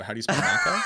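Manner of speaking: put-on loud, baffled retort in Boston accent; mock-indignant Bostonian comeback; incredulous echo. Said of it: "And I go".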